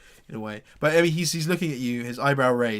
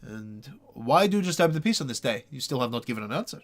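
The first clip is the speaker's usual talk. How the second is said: raised, louder threat of the stocks; impatient town official demanding an answer, raised and loud; stern burgomaster; 5 semitones higher, 5 dB louder than usual. Acted. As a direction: press the prisoner, rising up impatiently.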